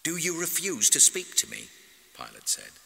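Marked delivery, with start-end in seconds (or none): 0.0-1.7 high, loud, incredulous question; imperious outraged questioning; indignant demand. A narrator's reading. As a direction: demand an answer, voice raised. Pilate angrily questions silent Jesus.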